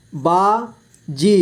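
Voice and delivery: बाजी split slowly into बा, जी; syllable by syllable. Shown as a demonstration. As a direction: stretch and separate बा and जी in बाजी. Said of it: "इस तरह से आपको". (बाजी को तोड़कर धीरे: बा, जी)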